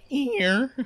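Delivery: high cracked squeak; voice cracking. Real, not acted. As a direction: voice breaks up high. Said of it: "still going through puberty".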